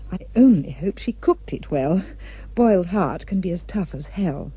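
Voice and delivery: ordinary delivery, nothing marked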